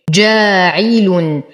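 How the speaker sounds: slow جاعل, aa held two counts; natural madd. Shown as a demonstration. Teacher reciting for students, slow measured جاعل. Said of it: "فهو مد طبيعي حركتين".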